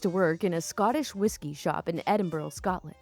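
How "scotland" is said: usual speaking voice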